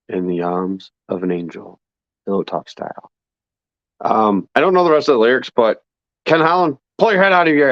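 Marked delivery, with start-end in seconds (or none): 0.1-3.1 low, soft, mournful crooning; sad Pillow Talk voice. Pretend. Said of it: "my sad Pillow Talk voice".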